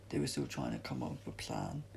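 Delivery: ordinary delivery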